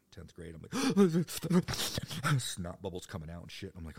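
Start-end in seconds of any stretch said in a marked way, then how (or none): 0.8-2.3 loud, high blubbering gasps; mock crying gasps. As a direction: imitate sobbing out of breath. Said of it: "and I'm crying".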